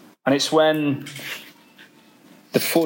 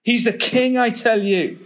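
raised, insistent beaver voice; emphatic Mister Beaver; 6 semitones higher than usual. A narrator's reading. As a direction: insist he's good, full of awe. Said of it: "said Mister Beaver".

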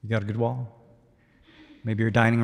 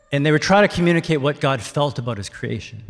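ordinary talk

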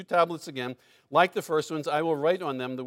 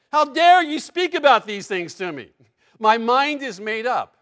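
much higher and louder indignant outburst; imagined angry God; 8 semitones higher, 12 dB louder than usual. Pretend. God rebuking Moses as the people expected, mock furious. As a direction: let the wrath of God erupt, hammed up. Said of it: "That's what you would anticipate".

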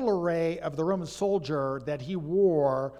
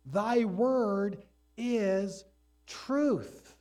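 slow, rising solemn quotation; quoting Jesus; 4 semitones higher, slower than usual. Retold or imitated. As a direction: let each word land. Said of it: "Jesus said".

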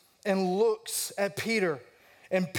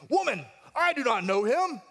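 emphatic denial; 6 semitones higher, 4 dB louder than usual. Acted. Peter denies it to servant girl.

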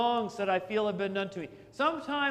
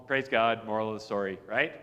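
ordinary talk

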